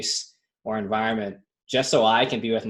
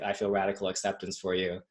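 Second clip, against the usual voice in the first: mock serene; 5 semitones lower, 10 dB quieter than usual. Mocking serene reply to punch.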